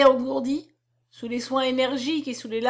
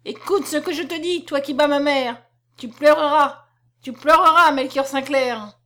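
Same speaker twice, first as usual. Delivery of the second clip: loud, high furious curse; furious shout; 3 semitones higher, 6 dB louder than usual. Acted. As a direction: hurl the curse at him at full throat. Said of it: "cria à pleine gorge".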